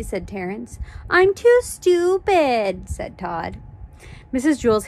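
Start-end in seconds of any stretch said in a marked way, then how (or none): 1.1-2.9 high, loud drawn-out whine; sing-song childish complaint; whiny excuse. Acted. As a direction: whine it slowly, stretching the last word. Classmates join in the whining.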